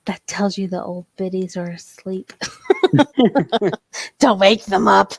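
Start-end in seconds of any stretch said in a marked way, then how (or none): 2.9-5.2 laughing. Real, not acted. Joking about sleeping viewers.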